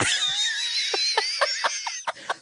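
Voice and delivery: high unvoiced burst of laughter; wheezing laugh at her mother's joke; big laugh. Real, not acted. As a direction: cackle at the memory. Laughing at mom's remark.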